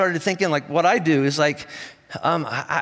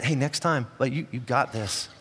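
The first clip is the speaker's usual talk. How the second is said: gentle whisper; 6 semitones lower, 8 dB quieter than usual. Retold or imitated. Jesus softly encourages Peter, close and gentle. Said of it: "whispered into Peter's ear".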